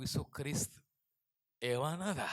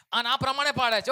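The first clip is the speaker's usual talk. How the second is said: loud, high preacher's cry; rising revival sermon shout; fiery preaching; 10 semitones higher, 12 dB louder than usual. Acted. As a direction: preach it out with full force. Preaching at full force.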